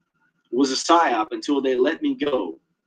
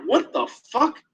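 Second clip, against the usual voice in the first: high, shocked reaction; startled; 10 semitones higher, slower than usual. Retold or imitated. Hearing a voice in his head, startled. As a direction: jolt of disbelief, pitched up. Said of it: "I'm like".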